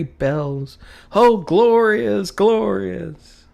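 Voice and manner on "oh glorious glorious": high, slow, drawn-out rapture; rapturous. A narrator's reading. Scrooge hearing the church bells ring, rapturous. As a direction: savour the word glorious.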